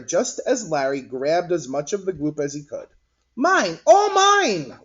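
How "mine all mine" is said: very high, loud greedy squeal; greedy shout. Acted. Larry grabbing the gloop, loud greedy shout. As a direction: grab it all and squeal it. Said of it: "Larry said".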